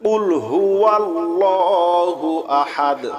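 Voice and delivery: slow, melodic tajweed chant; slow Quranic recitation. Shown as a demonstration. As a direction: draw out each word in recitation. Model recitation.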